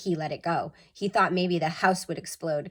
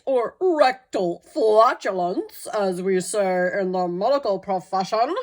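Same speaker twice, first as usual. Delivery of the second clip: the vet; 6 dB louder than usual. A narrator's reading. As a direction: lecture the family pompously. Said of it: "said the vet".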